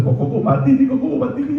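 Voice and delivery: very high, stammering disbelief; imitated alarmed onlooker. Preacher acts someone finding him dead, high-pitched mock alarm. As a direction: squeal it in mock panic, tripping over the first word. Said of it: "Terus dia bilang".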